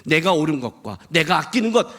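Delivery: ordinary delivery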